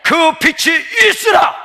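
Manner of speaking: slow, shouted proclamation. Acted. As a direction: stretch it out and cry it loudly. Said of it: "더 크게".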